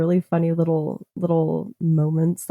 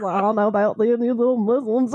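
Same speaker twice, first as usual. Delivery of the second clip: imitating the narrator's Texan accent; 4 semitones higher, faster than usual. Friend mocks the audiobook narrator's Texan accent. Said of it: "when he acts like the guy from Texas".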